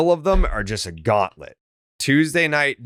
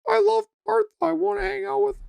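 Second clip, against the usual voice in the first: squeaky falsetto mimic; whiny high mock voice. Mocking Tyler's excuse, high voice.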